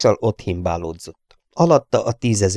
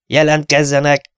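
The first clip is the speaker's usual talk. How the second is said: loud barked order; sharp command; 6 semitones higher, 5 dB louder than usual. A narrator's reading. Pilot ordering the crew to report, sharp command. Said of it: "csattant Dennis parancsa".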